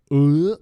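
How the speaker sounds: loud imitated retch. Drunk Mitchell mid-story in the cab, loud mock retch. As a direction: heave as if about to vomit. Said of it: "Mitchell just goes".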